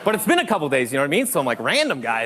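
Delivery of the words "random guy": low, puzzled question. Acted. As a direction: mull over an unfamiliar name, baffled. Acts out puzzling over caller ID.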